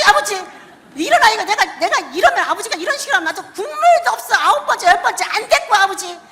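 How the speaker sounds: loud, high, half-laughing grumble at the Father; whining complaint to God. Retold or imitated. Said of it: "아버지 앞에 막 그러면서". (하나님께 투정 부리며 씩씩대는 목소리)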